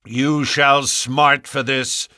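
slow, furious warning; raging threat. A narrator's reading. Stangerson threatening Ferrier, slow with rage. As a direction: spit out the threat.

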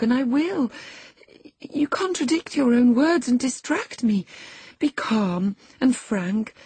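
high, earnest appeal; moved and alarmed. A narrator's reading. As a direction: urge him anxiously to explain. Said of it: "she grew thoroughly moved and alarmed".

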